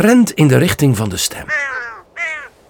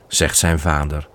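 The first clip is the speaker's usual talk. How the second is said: ordinary delivery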